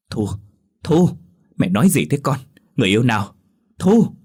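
loud, rising cries of a worried mother; frantic calling. A narrator's reading. Mother shaking daughter, calling. (giọng mẹ gọi con hốt hoảng, to dần)

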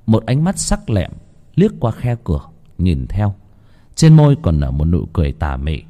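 ordinary delivery, nothing marked

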